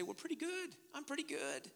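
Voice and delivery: small, high, soft mimicry; mock a meek little self-excuse; self-justifying people. Mimicking people who justify themselves.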